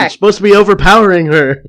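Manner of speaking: high, loud berating; acted outburst at an actor; yelling director. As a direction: shout it like a fed-up director. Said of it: "the director's yelling at".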